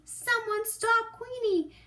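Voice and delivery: very high, loud call for help. Acted. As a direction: shout for someone to stop the dog. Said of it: "cries Quincy".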